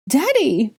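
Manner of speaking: high, loud scream; child shrieking for father; child's scream. A narrator's reading. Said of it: "Aurelian screamed".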